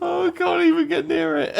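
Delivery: high, loud words through laughter; helplessly amused; laughing. Real, not acted. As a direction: admit defeat while cracking up. Laughing at own failed accent.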